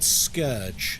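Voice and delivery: "scourge" with an UR vowel, SKURJ; "scourge" drawn out as skurj; correct pronunciation. Shown as a demonstration. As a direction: say "scourge" slowly to rhyme with urge. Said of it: "one of my favorite words that I hear mispronounced".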